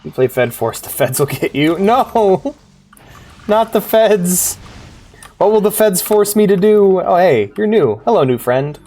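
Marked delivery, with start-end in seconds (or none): 1.8-7.0 hammed-up dread, high and slow; mock horror. Gamer hams up fear of the feds. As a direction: play at being terrified of the feds.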